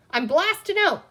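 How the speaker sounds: put-on high, loud boyish bandit cry; a boy bluffing his way out with a toy pistol; bold cry. A narrator's reading. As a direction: call it out like a tough outlaw. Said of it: "cried Peter".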